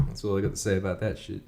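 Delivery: ordinary talk, nothing marked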